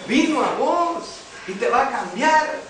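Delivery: loud, high proclamation; emphatic preaching. Real, not acted. Preacher tells listener the Spirit came, loud emphatic preaching. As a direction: raise the voice and proclaim it with force.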